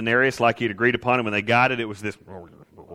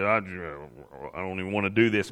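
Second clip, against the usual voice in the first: soft, lower, slowed-down groan of reluctance; reluctant grumble; 5 semitones lower, 6 dB quieter than usual. Retold or imitated. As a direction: mumble it like you really don't want to. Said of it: "the grunt that comes out whenever you just".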